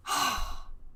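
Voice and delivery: heavy, voiceless sigh. Real, not acted. Streamer after being robbed, sighing. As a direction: let out a long huff.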